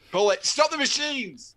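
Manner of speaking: high, loud command to stop the line; panicked order. Pretend. Boss ordering the factory shut down, high panicked shout. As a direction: yell to halt the machines.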